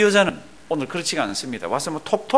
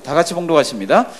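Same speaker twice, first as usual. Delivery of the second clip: ordinary delivery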